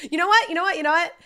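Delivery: loud, high repeated you know what; playful loud challenge; excited outburst. Pretend. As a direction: blurt it out brightly each time. Rehearsing clip, loud high lines.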